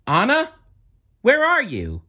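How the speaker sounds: searching call into the snow. A narrator's reading. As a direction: call out to a hidden sister. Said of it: "Elsa called".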